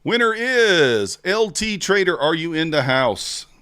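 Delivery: high, slow, loud announcer voice; announcer call. Acted. Streamer announcing the giveaway winner to chat like a game-show host.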